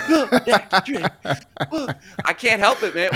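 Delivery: can't stop laughing while talking. Real, not acted. Cracking up at cohost's joke.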